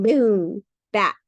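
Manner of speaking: ordinary delivery, nothing marked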